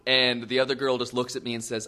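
normal talking voice

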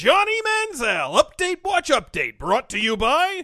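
high, loud, slow announcer call; loud announcer jingle. Acted. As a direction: belt it like a TV promo. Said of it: "repeated segment title".

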